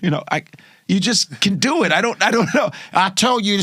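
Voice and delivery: ordinary talk, nothing marked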